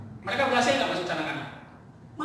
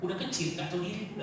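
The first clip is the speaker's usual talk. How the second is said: quiet aside; 3 semitones lower, 8 dB quieter, faster than usual. Shown as a demonstration. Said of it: "quiet aside before loud repeat".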